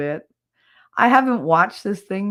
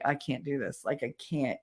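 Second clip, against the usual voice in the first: much softer, drained protest; retold weak surrender; 4 semitones lower, 11 dB quieter than usual. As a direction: drop the volume as if giving up. Retelling feeling sick, giving up.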